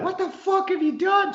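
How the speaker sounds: very high-pitched mock scream; retold scream. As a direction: re-enact screaming in outrage at the dog. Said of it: "But I screamed out".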